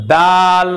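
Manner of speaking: ডাল with the tongue tip on the upper tooth ridge; teacher demonstrating ডাল pronunciation; Arabic dal. Shown as a demonstration. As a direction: say ডাল from the gum ridge behind the teeth. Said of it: "এইবার ডালটা বলার সময় আমাদের জিহ্বা ঠিক যেখানে গিয়েছিল".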